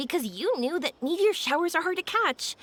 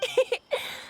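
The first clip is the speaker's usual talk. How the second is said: bright giggle; 8 semitones higher than usual. Acted. Yoimiya laughs at the surprise.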